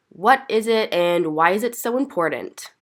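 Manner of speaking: ordinary delivery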